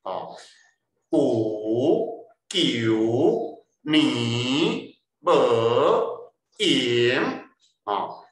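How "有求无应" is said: loud, long rising swoop on each of 有求 无应; forceful upward glide on every syllable of 有求 无应; rising fifth tone. Shown as a demonstration. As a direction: drag 有求 无应 out, each syllable dipping then surging up. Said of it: "第五声是冲气".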